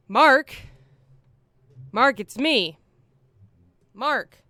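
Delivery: loud calls of a name outside a door; calling through door. Retold or imitated. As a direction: shout the name to get someone to open up. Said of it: "someone's saying".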